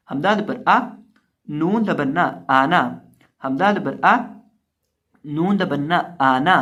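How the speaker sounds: loud, chanted letter-by-letter drill; Quran spelling recitation. Acted. Teacher chanting the letters so students repeat.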